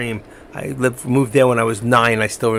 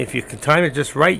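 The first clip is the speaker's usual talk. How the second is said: ordinary talk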